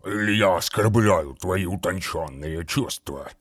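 low, wet, bubbling monster voice; gurgling voice. A narrator's reading. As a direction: gurgle it out like a rotting daemon. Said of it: "пробулькал омерзительный голос".

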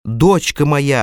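ordinary delivery

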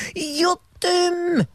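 very high gleeful squeal; Bram's shriek. Acted. Bram squealing at the promise of fame, high gleeful shriek. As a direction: shriek with childish joy. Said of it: "gilde Bram".

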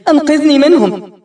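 high, loud cry for rescue; a shrieking plea for help; desperate scream. Acted. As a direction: scream it out to him in terror. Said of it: "أصرخ إليه".

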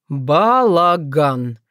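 slow, drawn-out word on a sigh; stunned sigh. A narrator's reading. Lad stunned by the sight, sighing slowly. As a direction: let it out slowly, astounded. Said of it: "вздохнул поражённый увиденным Богдан".

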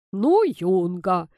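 loud, drawn-out grandfatherly grumble; mock-stern grumble, stretched out; grumbling. A narrator's reading. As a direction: scold the cheeky youngster, slowly. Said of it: "проворчал дедушка".

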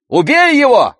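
very high, loud yell; shouting. A narrator's reading. Brother urges sister to kill him, shouting. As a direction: yell the command. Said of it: "крикнул Андрей".

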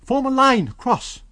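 high, loud command; rally the crowd into a line; shouted order. A narrator's reading. Kemp ordering the crowd, loud shout. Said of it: "he cried".

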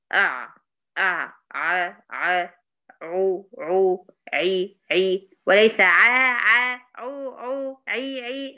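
slow drawn-out عو عي with the jaw open; wrong ع drill. Shown as a demonstration. Teacher demonstrates the wrong ع sounds. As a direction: stretch ع، عو، عي slowly. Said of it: "لا، ده غلط".

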